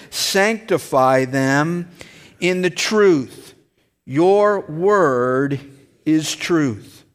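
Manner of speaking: slow, deliberate reading of the verse; scripture reading. Acted. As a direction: read it out to the congregation slowly.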